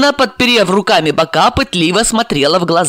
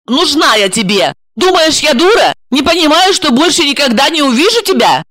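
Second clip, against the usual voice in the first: angry; 9 semitones higher, 3 dB louder than usual. Acted. Friend raging at betrayal.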